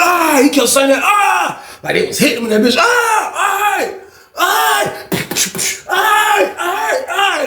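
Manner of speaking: high yelping aye cries; pained cries. Retold or imitated. Beaten cheater cries out while being hit, high yelps. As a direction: yelp as each blow lands.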